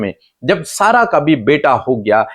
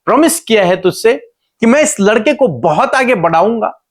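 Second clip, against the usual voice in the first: higher-pitched, insistent divine reassurance; God explaining; 8 semitones higher than usual. Retold or imitated. God explains his promise to the worried Hagar, raised insistent voice. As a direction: pitch it up and press each question home as God. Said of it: "परमेश्वर उसे समझाने की कोशिश कर रहे हैं".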